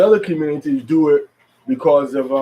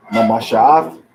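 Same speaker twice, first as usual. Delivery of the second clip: Mamasha'ath with inflection on the next-to-last syllable; 4 semitones lower, 3 dB louder, slower than usual. Shown as a demonstration. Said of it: "next to the last syllable".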